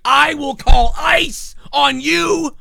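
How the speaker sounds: high, slow, hammed-up shouted threat; mock furious threat. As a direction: bellow the threat like an enraged customer. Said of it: "walk around being like".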